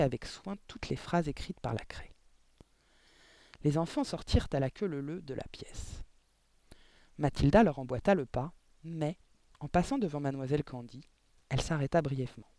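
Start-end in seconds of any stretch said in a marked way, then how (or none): none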